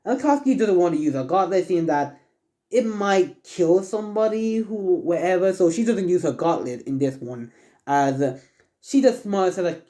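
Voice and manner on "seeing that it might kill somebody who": usual speaking voice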